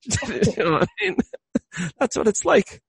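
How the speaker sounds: high-pitched, talking through giggles; breaking up with amusement; laughing. Real, not acted. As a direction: keep laughing as you get the words out. Still laughing at his analogy.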